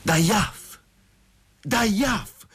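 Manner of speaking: high-pitched anguished groan. A narrator's reading. Abandoned lover spots Masha and groans, anguished. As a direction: groan the name out high, in pain. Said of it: "gemé Chertovkhanov".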